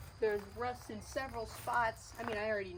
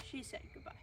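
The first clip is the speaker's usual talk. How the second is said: whisper; 4 semitones higher, 12 dB quieter, faster than usual. Pretend. Whispered reply for the bus.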